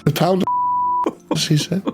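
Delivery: high shopkeeper voice breaking into giggles; shopkeeper gossip, laughing. Acted. Shopkeeper sharing gossip with customer, giggling.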